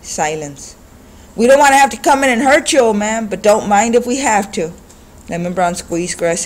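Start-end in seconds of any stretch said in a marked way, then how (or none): 1.3-4.7 higher, louder, fast menace; threatening thug. A narrator's reading. Thug warning the old man, fast and loud. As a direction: rush the threat at him.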